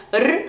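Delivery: R as a strongly rolled tongue-tip trill. Shown as a demonstration. Teacher demonstrating the Spanish strong R, rolled trill. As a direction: vibrate the tongue hard for R. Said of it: "só que bem vibrado".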